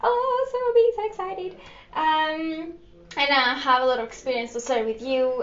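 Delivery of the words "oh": ordinary delivery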